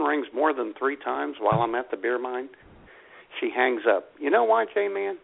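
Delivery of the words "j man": ordinary talk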